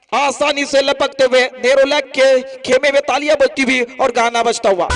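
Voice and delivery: high, loud roaring play-by-play; excited cricket commentary. Real, not acted. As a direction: call the six at the top of your voice. Live catch called at full pitch.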